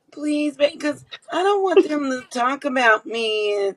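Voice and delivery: high-pitched mock pleading; begging. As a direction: plead like a needy wife. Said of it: "do she be in that motherfucker begging".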